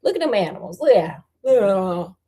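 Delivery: ordinary delivery, nothing marked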